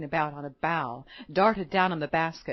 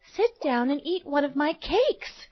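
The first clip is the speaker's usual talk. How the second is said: lightly voiced girl, higher pitch; kind little girl; 6 semitones higher than usual. A narrator's reading. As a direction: offer it sweetly as a small girl. Girl offers old woman cake.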